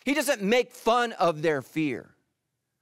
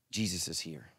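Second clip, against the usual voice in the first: hushed tender; 11 semitones lower, 9 dB quieter than usual. Real, not acted. Comforting covid sufferers.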